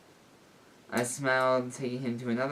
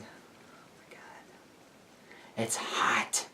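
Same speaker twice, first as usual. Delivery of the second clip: whisper; 6 dB quieter, much slower than usual. Real, not acted.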